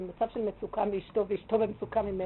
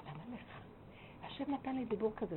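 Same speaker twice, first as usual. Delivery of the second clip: suddenly gentle, much softer voice; soft speech; 10 dB quieter than usual. Retold or imitated. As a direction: drop softly to soothe an angry man. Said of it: "פתאום התחלתי לדבר אליו ברכות". (דיבור רך ושקט)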